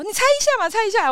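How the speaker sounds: high, coaxing 你猜一下嘛 tease; a playful guy egging her on; his playful coaxing, voiced high; teasing guy. Teasing driver voiced in story.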